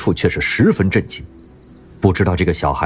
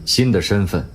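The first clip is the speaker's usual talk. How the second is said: emotionless; 3 semitones lower, 5 dB quieter than usual. Acted. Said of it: "声音仍然是那样毫无情感".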